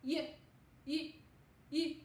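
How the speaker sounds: Y said as a quick clipped yuh; short fast Y sound. Shown as a demonstration. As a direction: tap out Y short and fast, three times. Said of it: "really short and fast".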